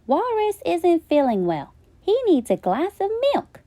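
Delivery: put-on high, hurried child voice; a small boy fussing over a sick friend; worried little boy. Flynn worried.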